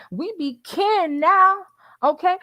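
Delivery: drawn-out high quote of a film line; playful movie quote, lightly voiced; quoting Color Purple. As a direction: stretch it slow and high like the movie. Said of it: "As we say over here".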